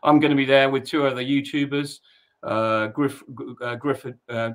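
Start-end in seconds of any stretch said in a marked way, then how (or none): none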